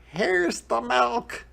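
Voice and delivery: put-on high boy's call; excited Jack shout. A narrator's reading. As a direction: call out like an eager boy. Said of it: "shouted Jack".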